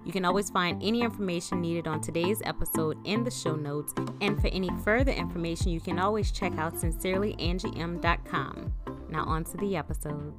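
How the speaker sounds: smooth host intro read; podcast intro read. Acted. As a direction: read it like a show opener. Podcast intro over music.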